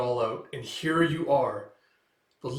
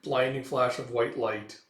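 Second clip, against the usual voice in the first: ordinary talk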